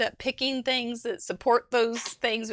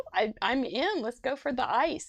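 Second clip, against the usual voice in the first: normal talking voice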